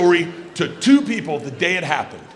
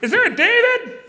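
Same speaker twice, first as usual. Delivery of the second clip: put-on very high, squeaky little-girl voice; Isla. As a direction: squeak it out like a small girl calling. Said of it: "Isla's little squeaky voice".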